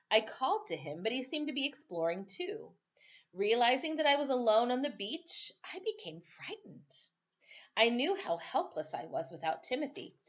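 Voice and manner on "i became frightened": hushed, scared whisper; whispered fright; frightened whisper. A narrator's reading. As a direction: breathe it out in fear. Narrator whispers fear.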